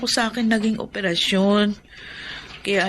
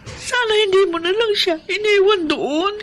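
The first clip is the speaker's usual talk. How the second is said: anguished plea; 11 semitones higher, 4 dB louder than usual. Acted. Mother fearing for daughter.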